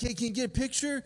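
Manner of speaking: imitated eager request, high-pitched and quick; excited Australian golfer. Australian golfer begs his partner for a squirrel photo, excited imitation. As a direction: ask fast and high, thrilled as a tourist. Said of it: "wanted me to take pictures of him".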